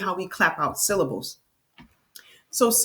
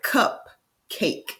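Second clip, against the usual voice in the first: "cupcake" broken into cup, pause, cake; "cupcake" syllable by syllable, for a learner; split into syllables; 2 semitones higher than usual. Shown as a demonstration. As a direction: say "cupcake" in two separate beats. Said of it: "Can you clap out cupcake?".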